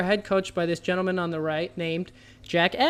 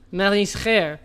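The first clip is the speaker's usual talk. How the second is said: French accent; 3 dB louder, slower than usual. Shown as a demonstration. Trivia host demoing manager with a French accent. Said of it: "if I were to pronounce it with a French accent".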